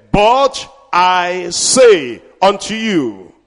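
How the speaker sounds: very slow, solemn pronouncement; slow weighty quote. Acted. Preacher quoting scripture to the church, very slow. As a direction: lay down each word slowly.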